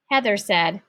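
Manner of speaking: ordinary delivery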